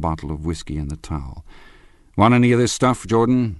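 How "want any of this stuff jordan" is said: raised, offhand call across the room. Acted. Husband offering whiskey to his friends, called out. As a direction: toss the offer over loud.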